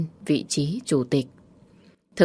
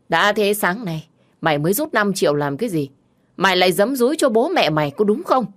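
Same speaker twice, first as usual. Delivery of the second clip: angry shouting; 3 semitones higher than usual. Acted. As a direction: tear into her with rising fury. Drunk husband berating wife.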